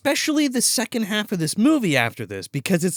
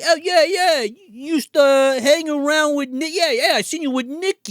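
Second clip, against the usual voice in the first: higher, louder hammed-up goon; high mock Italian guy; 8 semitones higher, 4 dB louder than usual. Goon taunting the cops about Nikki, high and hammy. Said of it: "He's like".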